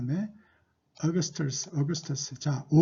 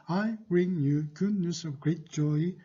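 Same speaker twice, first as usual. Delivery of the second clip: slow model reading; much slower than usual. Shown as a demonstration. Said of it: "자, 이어서 해볼까요?".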